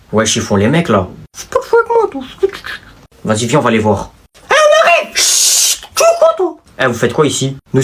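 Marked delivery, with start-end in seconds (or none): 1.4-2.2 put-on squeaky kid voice. Sidekick points out the other class in a squeaky voice. 4.5-6.6 much higher, slow put-on voice; squeaky kid voice. Sidekick points out the other class in a squeaky voice.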